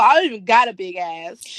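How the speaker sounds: ordinary talk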